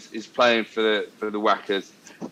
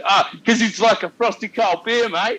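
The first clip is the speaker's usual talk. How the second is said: high-pitched, eager mimicry; imitated teammate's explanation; 8 semitones higher, 4 dB louder than usual. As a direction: play the mate who takes the bait. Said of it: "And he goes".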